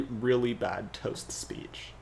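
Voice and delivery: ordinary talk, nothing marked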